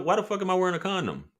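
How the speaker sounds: ordinary talk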